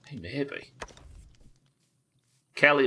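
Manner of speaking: ordinary delivery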